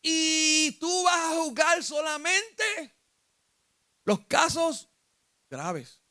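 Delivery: high, slow, stretched-out command; Jethro instructing Moses. Acted. Jethro tells Moses which cases to judge, emphatic. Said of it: "Jetro le dijo".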